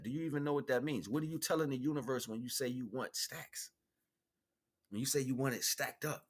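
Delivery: normal talking voice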